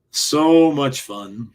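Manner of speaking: very slow, stretched emphasis on "So"; drawn-out relish. Real, not acted. Reminiscing about renting tapes at Blockbuster, drawn out with relish. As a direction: savour it, stretching out "So much". Savouring repeat of his own words.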